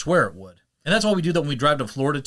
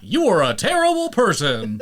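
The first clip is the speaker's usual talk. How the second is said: loud, higher smug game voice; mock announcer; 6 semitones higher, 5 dB louder than usual. Game judging Carl, loud smug mock verdict. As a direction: deliver the verdict with relish.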